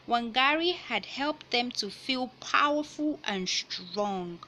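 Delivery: picture book read-aloud voice; reading to children. Acted. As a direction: clear storybook narration.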